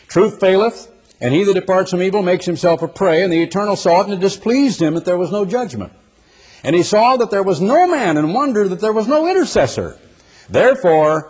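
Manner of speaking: high, emphatic Bible reading; scripture declamation. Real, not acted. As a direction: proclaim the prophecy from the pulpit.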